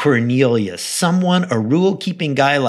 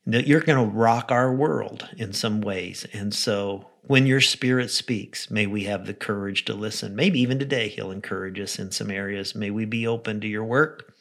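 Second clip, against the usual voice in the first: soft, lower praying voice; closing prayer; 3 semitones lower, 4 dB quieter than usual. Real, not acted. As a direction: pray quietly and sincerely for the listeners. Closes the talk in prayer.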